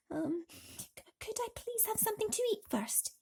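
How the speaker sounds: timid asking. Acted. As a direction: ask shyly, afraid of no. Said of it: "he asked".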